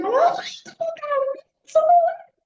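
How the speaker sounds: very high, shrieking yell; terrified scream. Retold or imitated. Mother screaming at her son to climb the rock, terrified. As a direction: shriek it in panic. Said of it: "eso era gritado así terroríficamente".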